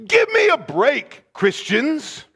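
preacher pushing back in exasperation; exasperated challenge. Shown as a demonstration. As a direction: scold them in mock disbelief. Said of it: "if I started a statement like that, you know I'm serious".

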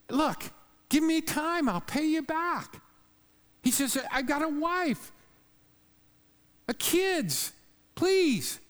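high, pleading plea for time, voice way up; begging fellow slave. Acted. Preacher voicing the fellow slave pleading for mercy, high and desperate. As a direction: plead in a rush, pitch climbing.

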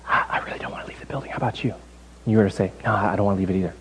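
hushed, low staged exchange; conspiratorial whisper. Acted. Church members plotting over losing the building, whispering together. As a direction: confide it under your breath. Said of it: "if I were to walk over and say".

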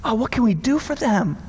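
whispered, pitying coo; mock sympathetic whisper. Imagined letter writer on single moms, soft pitying whisper. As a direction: whisper it like fake tender concern. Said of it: "He'd be like".

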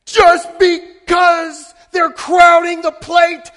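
loud, high, slow yelled protest; angry shout. Retold or imitated. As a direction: bellow at the umpire. Yelling at the umpire from fence.

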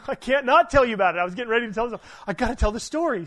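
can't hold it in, laugh through the words; excited laughing rush. Real, not acted. Pastor to congregation, eager to tell his week's story, laughing excitedly.

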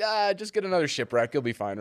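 high, breezy and loud, no worries; carefree island voice. Retold or imitated. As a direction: shrug it off like a relaxed islander. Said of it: "Everybody's like".

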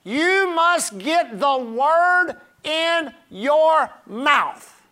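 "You must get the word" very slow, every word stressed; hammered emphasis. Real, not acted. Preacher urging the congregation, "your mouth" in slow hammered emphasis. Preacher drives the point home.

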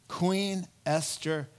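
ordinary talk, nothing marked

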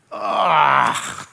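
exasperated groan. Retold or imitated. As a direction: groan as if caught out. Stumped by his son's question.

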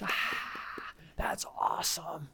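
whispered, restrained applause from a crowd; soft breathy murmur of approval; hushed cheering. Acted. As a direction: cheer under your breath so nothing shakes. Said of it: "if they clap too loud".

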